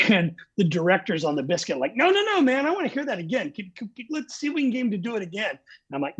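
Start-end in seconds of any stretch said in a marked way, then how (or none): 2.0-5.5 eager pleading. Retold or imitated. Director begging for retake.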